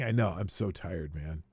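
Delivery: normal talking voice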